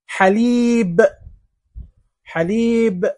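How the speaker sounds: "حليب" as haleeeeb, the ee held; long ee vowel. Shown as a demonstration. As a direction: stretch the second syllable of "حليب". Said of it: "كل هذه يكون فيها اللسان مكسورا". ("حليب" بمدّ الياء)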